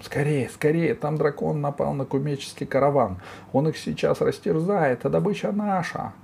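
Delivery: high-pitched urgent alarm; young robber's cry. A narrator's reading. Young robber bursting in with news, urgent high cry. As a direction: rouse the gang in a high, excited voice. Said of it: "ворвался молодой разбойник с криком".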